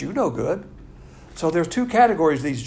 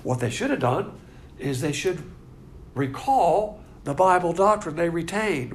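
normal talking voice

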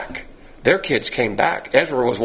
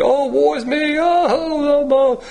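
mock woe-is-me wail; 11 semitones higher, slower than usual. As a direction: whine it like a melodramatic martyr. Mocks Job wailing in self-pity.

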